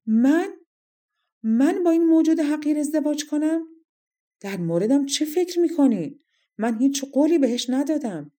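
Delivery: high, loud outraged king; indignant shout. A narrator's reading. King rejecting the bride he is offered, shouting indignantly. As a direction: cry out in offended disbelief. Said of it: "پادشاه فریاد زد".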